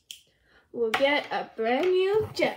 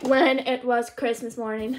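normal talking voice

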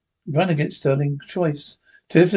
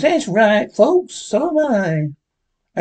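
a cheerful cowboy sidekick calling to the crowd; Jingles' voice; 6 semitones higher, much slower than usual. Acted.

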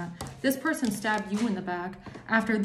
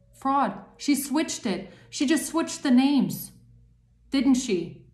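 higher, louder, accusing outburst; real anger; 5 semitones higher, 4 dB louder, slower than usual. Real, not acted. Tarot reader fuming at the card figure, real angry outburst. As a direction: spit out the accusation, furious. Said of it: "I'm getting so angry".

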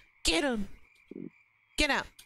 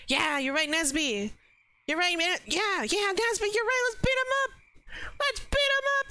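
higher, eager rallying shouts; excited cheering; 10 semitones higher than usual. Acted. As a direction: egg the crowd on gleefully. NPC cheering on a gang-up.

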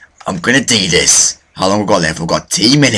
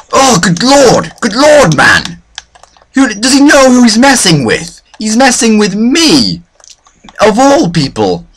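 indignant outburst; 10 semitones higher, 4 dB louder than usual. Real, not acted. Gamer outraged at who's messing with him, high indignant outburst. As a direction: protest loudly in high-pitched outrage. Outraged at being attacked in game.

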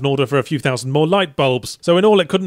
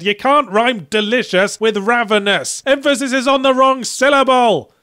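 high, slow, drawn-out outraged shout; hammed-up furious yell climbing higher; exasperated yell; 8 semitones higher, 4 dB louder, slower than usual. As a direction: yell it at the game, stretching words in fury. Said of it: "bitten my controller in half and yelled".

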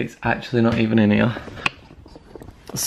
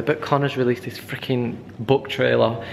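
ordinary talk

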